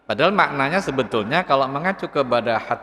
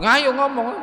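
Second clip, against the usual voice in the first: mock snap; 10 semitones higher, slower than usual. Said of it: "malah digepuk".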